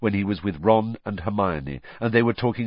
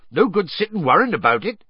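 higher, louder rough-spoken friend; Hagrid, stoutly reassuring; 10 semitones higher, 6 dB louder, faster than usual. A narrator's reading. Hagrid facing his friends' disbelief, stoutly reassuring. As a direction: buck them up stoutly.

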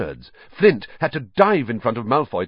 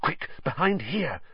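softer, urgent hiss; softer urgent hiss to hide; 7 semitones higher, 5 dB quieter than usual. Acted. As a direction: hurry them out of sight, hushed. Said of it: "Harry hissed".

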